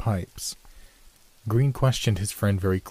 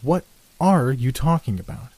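raised, louder, exasperated question; impatient challenge, higher and emphatic; 5 semitones higher, 5 dB louder than usual. A narrator's reading. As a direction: demand sense from a rambling friend. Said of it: "ejected the other impatiently".

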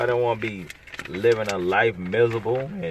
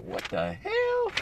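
shocked disbelief; 7 dB quieter, slower than usual. Retold or imitated. Imagined smoker hears cancer diagnosis.

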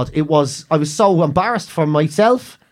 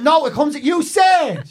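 indignant protest; 7 semitones higher, much slower than usual. Retold or imitated. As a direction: throw his own words back at him. Protesting to the doctor.

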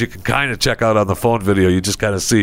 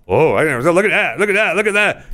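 squeamish employees; 7 semitones higher than usual. Retold or imitated. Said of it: "They're just".

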